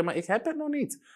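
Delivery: high-pitched mock objection; imitated protest. Woman tells the preacher she lacks healing, imitated high protest.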